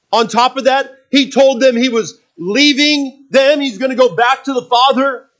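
full-force preaching. Acted. Preacher presses the question to the congregation, loud and urgent. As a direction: preach it out to the back row.

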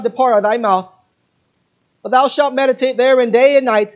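loud, high, driving preacher's cry of resolve; full-force preaching. Acted. Preacher builds to full-force declamation.

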